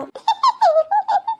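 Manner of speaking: high, open mocking laugh; daughter-in-law laughing at mother-in-law's missing car, mocking. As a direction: laugh at her about the car.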